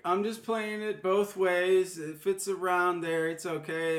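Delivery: lightly voiced lazy umpire excuse; mock umpire. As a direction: drawl it out like a careless ump.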